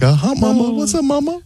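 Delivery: squeaky puppet hitting on mama; mock Elmo flirting. Mocking Elmo hitting on mom.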